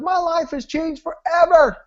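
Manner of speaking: high, elated declaration; bouncing excitement. Retold or imitated. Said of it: "he comes bouncing in".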